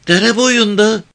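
put-on high-pitched girl's voice; high little reply; village girl. A narrator's reading. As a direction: answer him as a young village girl, pitched well up. Girl questioned by the man.

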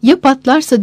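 anxious, pressing question; insistent child. A narrator's reading. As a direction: insist on the worry as a child would. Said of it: "diye ısrar etti çocuk".

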